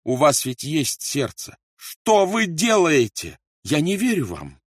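loud, high, slow desperate begging; pleading. A narrator's reading. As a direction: beg him, voice rising in horror. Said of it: "умоляя". (отчаянная громкая мольба)

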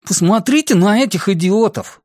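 put-on high, indignant teen voice; Бенни. A narrator's reading. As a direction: pipe up scornfully, pitched well up. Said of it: "подал голос Бенни". (возмущённый высокий голос подростка)

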